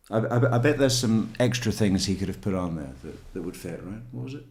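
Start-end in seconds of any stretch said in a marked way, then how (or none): none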